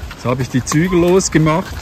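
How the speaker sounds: usual speaking voice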